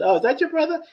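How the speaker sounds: high-pitched acted-out query; surprised question. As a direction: ask in a lifted, imitating voice. Acting sister's friend's question.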